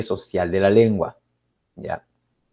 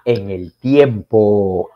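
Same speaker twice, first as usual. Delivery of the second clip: slow emphasis; 6 dB louder, much slower than usual. Shown as a demonstration.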